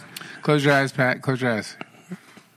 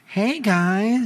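put-on higher girly greeting; mock girl voice; 7 semitones higher than usual. Teasing Pat with a girly greeting. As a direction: chirp it like a girl. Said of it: "you don't be driving around going".

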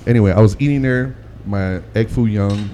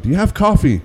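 put-on Indian accent; 8 semitones higher than usual. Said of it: "he's like".

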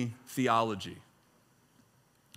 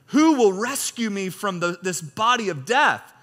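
raised, declaiming reading voice; scripture reading; 5 semitones higher, 4 dB louder than usual. Acted. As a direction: read Paul's words like a proclamation. Reads scripture aloud with force.

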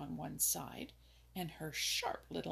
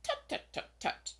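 sharp scolding voice. A narrator's reading. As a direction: repeat the tutting in a scold. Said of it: "in her sharp, scolding voice".

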